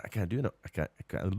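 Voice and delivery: usual speaking voice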